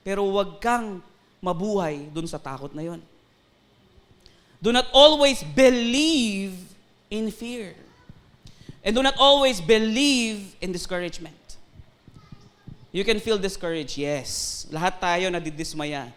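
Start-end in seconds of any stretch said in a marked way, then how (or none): 4.6-11.4 1 preaching emphasis. Real, not acted. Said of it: "preaching emphasis".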